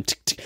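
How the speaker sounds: quick, soft tick-tick; quiet little ticking noise; imitated ticking. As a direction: mimic a background tick under the talk. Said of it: "tick in the background".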